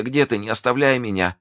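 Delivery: high, weakening dying woman; plaintive lifted voice; desperate plea. Acted. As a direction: plead as life ebbs, slowing at the end. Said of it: "вскричала госпожа Бонасье".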